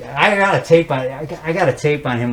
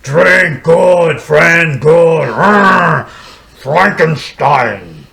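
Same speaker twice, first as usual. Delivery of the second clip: loud, grunting monster shouts; Frankenstein's monster; 2 semitones higher, 5 dB louder, much slower than usual. Retold or imitated. Monster praising drink to his friend, loud grunts. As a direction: bellow broken words like the monster. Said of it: "the monster goes".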